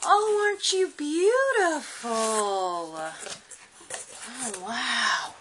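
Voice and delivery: high, loud, delighted cooing; gasp, then gush over how beautiful it is; gushing over a pet. Real, not acted. First sight of the lizard.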